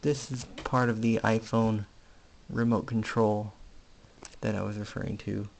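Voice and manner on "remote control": normal talking voice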